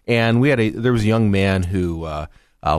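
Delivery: usual speaking voice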